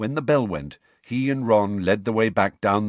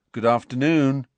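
ordinary delivery

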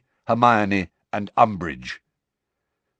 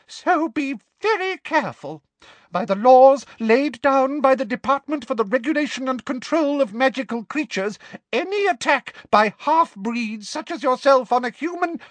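very high-pitched, officious warning; frightened official, pitched right up; 5 dB louder than usual. A narrator's reading. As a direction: recite the law in a high, frightened voice. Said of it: "in an even higher voice".

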